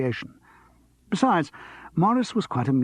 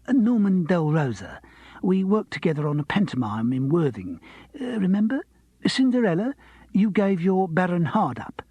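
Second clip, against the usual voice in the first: higher, gushing showbiz voice; eager pianist voice; 6 semitones higher than usual. Acted. Pianist reminding Charles of panto, higher gushing voice. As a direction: gush with eager recognition. Norman introduces himself eagerly.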